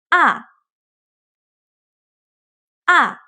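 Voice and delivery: Ah with a sharp falling fourth tone. Shown as a demonstration. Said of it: "So let's try it again".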